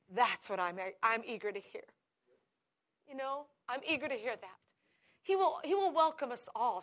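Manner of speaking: ordinary talk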